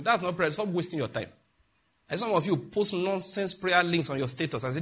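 ordinary delivery

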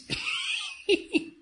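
a sudden high-pitched laugh; high squealing laugh. Real, not acted. Preacher laughing at the congregation, high squealing laugh. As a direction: squeal with laughter at your own point.

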